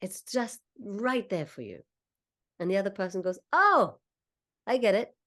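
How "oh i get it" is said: high, loud "oh" of dawning understanding; sudden realization. Acted. Listener finally grasping the explanation, delighted realization. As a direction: act out the penny dropping, pitched up.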